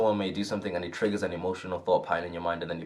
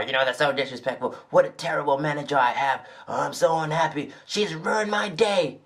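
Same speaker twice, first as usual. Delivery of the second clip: hammed-up wounded coworker whining to mates; whiny complaining; 7 semitones higher than usual. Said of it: "telling all your mates".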